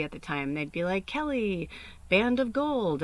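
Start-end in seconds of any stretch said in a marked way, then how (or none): 1.0-3.0 calling out request. Retold or imitated. Bar patrons requesting songs.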